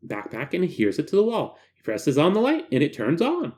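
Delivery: ordinary talk, nothing marked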